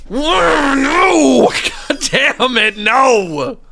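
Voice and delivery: high, loud laughing cry of despair; anguished no. Real, not acted. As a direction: wail it out in mock despair. Boss fight dread.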